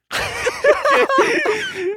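loud, high laugh burst; high burst of laughter. Real, not acted. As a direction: crack up hard. Laughing at dipshit buyer.

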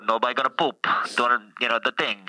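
put-on Italian accent as the Pope; thick Italian-English accent, playing the Pope; comic Italian accent as the Pope.

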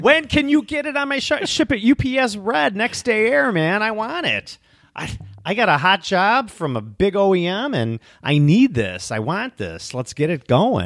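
high, pushy customer voice, fading to plain; demanding customer. Retold or imitated. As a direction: play an impatient customer rushing an order. Voicing a demanding customer's order.

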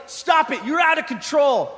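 high, urgent mock referee; referee breaking it up. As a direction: order a wild boxer to stop. Acts the referee stopping the fight.

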